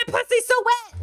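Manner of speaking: squealing high, mocking fans; high mock gushing. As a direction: gush like a swooning fan, mockingly. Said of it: "everyone's like".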